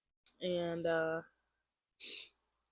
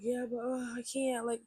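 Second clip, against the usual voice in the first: high-pitched whiny mock crying; retold tearful protest, pitched up; 6 semitones higher than usual. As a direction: plead helplessly, imitating tears. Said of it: "And I was just crying".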